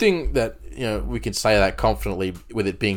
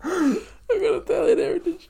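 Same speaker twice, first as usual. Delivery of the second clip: mock crying. Mock emotional pride.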